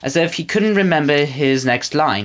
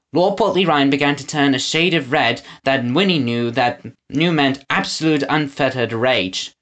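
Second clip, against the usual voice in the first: plain reading voice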